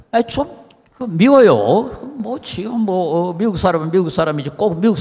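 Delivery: exasperated mock complaint at a Korean-looking American; mock annoyance. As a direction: let the exasperation drag out, half joking.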